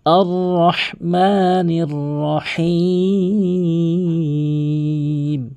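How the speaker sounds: "আর রহমানির রহিম" chanted slowly with throaty h; correct Qur'anic recitation. Shown as a demonstration. Teacher modelling the verse for learners, melodic recitation. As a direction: draw out "রহিম" in a long held chant. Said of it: "আর বলতে হবে".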